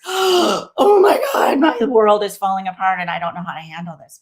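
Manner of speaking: overwhelmed boss in a panicky voice; mock panic. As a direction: ham up the panicked executive falling apart.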